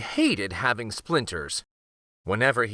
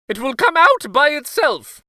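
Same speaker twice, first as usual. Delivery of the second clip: much higher, louder stubborn protest; insistent king; 8 dB louder than usual. Acted. King to the queen, high stubborn insistence. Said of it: "said the king".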